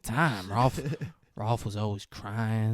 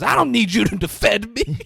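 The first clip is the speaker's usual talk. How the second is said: loud, higher, indignant snap; angry retort; 11 semitones higher, 11 dB louder than usual. Retold or imitated. Rolf telling Carol off, loud indignant snap. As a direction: bark it back, wounded pride.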